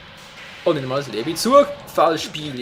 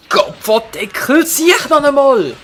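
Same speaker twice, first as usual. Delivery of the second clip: loud, high furious yell; angry shout; 10 semitones higher, 9 dB louder than usual. Real, not acted. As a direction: scream in rage. Raging at the game.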